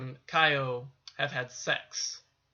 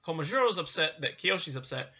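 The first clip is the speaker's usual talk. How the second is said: ordinary delivery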